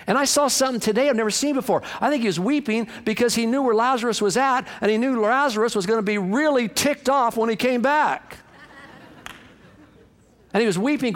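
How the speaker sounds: high, driving preacher's delivery; excited preaching. Real, not acted. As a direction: preach it as a thrilling discovery. Preaches a new insight.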